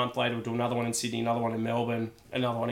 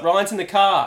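urgent warning; 7 semitones higher, 10 dB louder than usual. Retold or imitated. Mate warns caller, hushed panic.

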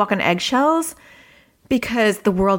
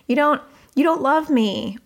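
put-on higher-pitched, hurt kid; sensitive child; 6 semitones higher than usual. Sensitive child protesting to a parent, hurt. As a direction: imitate a sensitive child's complaint. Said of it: "things like this from a sensitive child".

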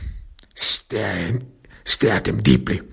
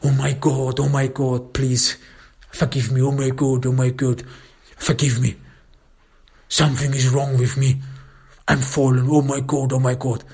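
acted tearful begging, higher pitched; bursting into tears; 3 semitones higher than usual. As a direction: wail for forgiveness as the weeping man. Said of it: "he again bursts into tears".

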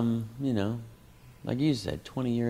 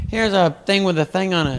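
higher, louder goofy prop-comic voice; mocking the opener; 8 semitones higher, 6 dB louder than usual. Comic mimicking the opener doing his props, goofy and loud. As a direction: ham up the stolen bit. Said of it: "I hear, like".

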